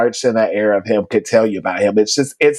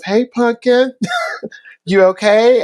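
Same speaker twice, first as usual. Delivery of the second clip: a higher, kind check-in with a hurt student; gentle mentor; 10 semitones higher than usual. Retold or imitated. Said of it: "and he goes".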